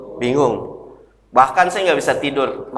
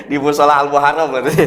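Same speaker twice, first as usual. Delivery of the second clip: laughing; 3 semitones lower than usual. Real, not acted. Laughing at his own joke.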